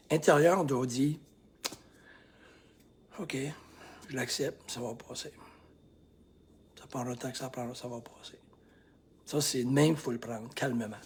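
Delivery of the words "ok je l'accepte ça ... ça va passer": soft, calm self-talk; calm inner acceptance. Shown as a demonstration. Coach showing inner calm, softly spoken. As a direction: quietly reassure yourself. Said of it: "Je vais vous montrer comment vous".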